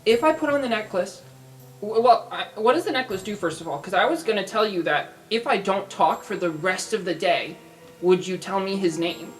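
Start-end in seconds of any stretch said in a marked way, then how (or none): none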